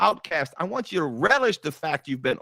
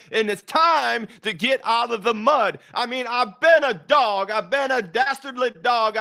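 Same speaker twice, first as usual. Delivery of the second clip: preached quote; 6 semitones higher than usual. Acted. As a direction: proclaim it like a rallying cry. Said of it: "That's how I say it".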